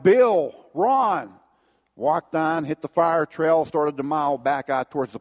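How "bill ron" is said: calling out loud and higher; retold yell. Wounded hunter yelling for his buddies Bill and Ron. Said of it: "But I yelled".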